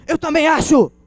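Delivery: high, loud mock indignation; hammed-up outrage on the customer's behalf; outraged salesperson. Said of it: "O cara já calma".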